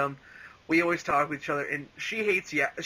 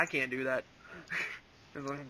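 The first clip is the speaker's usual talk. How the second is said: ordinary talk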